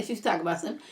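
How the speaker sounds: ordinary delivery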